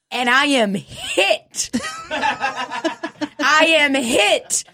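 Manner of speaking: very slow, laughing through the words; real laughter breaking the line. Real, not acted. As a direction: drag it out, barely holding in laughter. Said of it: "laughing while telling".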